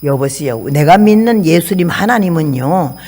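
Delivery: ordinary talk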